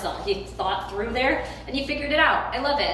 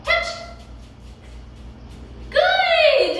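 very high, loud burst of praise; excited cue and praise; 4 dB louder than usual. Real, not acted. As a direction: shout the reward with joy. Cue and reward.

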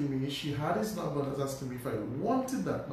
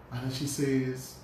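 ordinary talk

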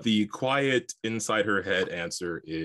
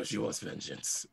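quieter second take at the character voice; hushed character line after the laughing; 7 dB quieter than usual. Acted. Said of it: "You gotta say it in the voice".

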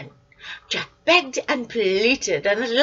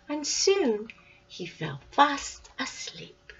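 plain reading voice

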